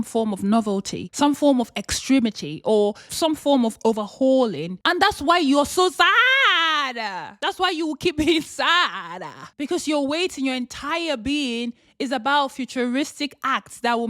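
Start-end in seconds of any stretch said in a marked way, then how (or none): 4.9-9.2 emphatic call-out. Real, not acted. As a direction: hit it hard and slow. Sudden punchline, loud slow.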